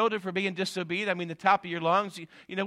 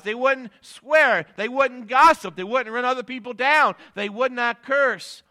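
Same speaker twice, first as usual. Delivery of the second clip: loud, higher, slowed preaching on the key questions; full-force preaching; 7 semitones higher, 9 dB louder than usual. Acted. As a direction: drive it home to the congregation. Preaching build to climax.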